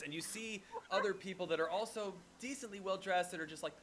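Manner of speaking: ordinary talk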